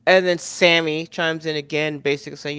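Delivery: normal talking voice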